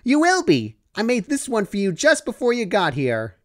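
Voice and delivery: high, chirpy pony voice, upbeat; cheerful Pinkie Pie. Acted. Pinkie reassures Dash she'll do the work, high and chirpy. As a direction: chirp it brightly.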